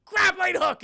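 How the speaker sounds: excited high shout; Mabel. Retold or imitated. She launches it into the air, shouting excitedly. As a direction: yell it like a thrilled kid with a new toy. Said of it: "she's so excited about the grappling hook".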